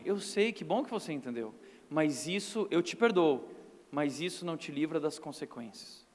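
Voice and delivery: low, soft, unhurried father's verdict; calm stern father. Retold or imitated. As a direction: speak softly as the father who still punishes. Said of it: "meu pai virava e falava assim". (pai calmo e firme, voz baixa e suave)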